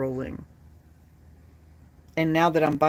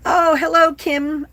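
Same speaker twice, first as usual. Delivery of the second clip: excited hello; 12 semitones higher, 9 dB louder than usual. Real, not acted. Greeting a viewer loudly.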